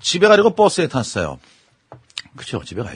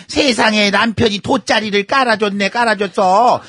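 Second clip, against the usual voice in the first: higher, hammed-up disbelief; scandalized gossip; 6 semitones higher, 3 dB louder, slower than usual. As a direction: react like a neighbor hearing juicy scandal. Acted listener reacting to gossip.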